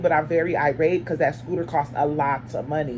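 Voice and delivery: ordinary talk, nothing marked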